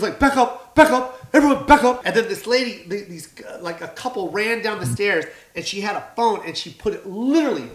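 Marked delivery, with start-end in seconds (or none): 0.2-2.0 loud, high urgent command; shouted order. Retold or imitated. Performer on stage to surging audience, shouted order. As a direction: yell to push a crowd back.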